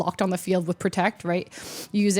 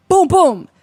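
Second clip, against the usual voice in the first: louder, higher victory boom; triumphant boom; 7 semitones higher, 9 dB louder than usual. Real, not acted. Telling viewers about winning game three, excited. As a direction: punch out the win. Celebrating the two-oh win.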